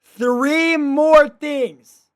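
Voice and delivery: high, loud, slowly drawn-out words; slow, high wail of dismay; exasperated outcry. Real, not acted. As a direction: stretch each word in loud disbelief. Exasperated reaction to the video.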